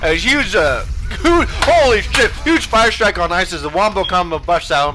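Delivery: loud, high excited play call; hype shout. Real, not acted. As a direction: scream the highlight with hype. Caster hypes a big ultimate.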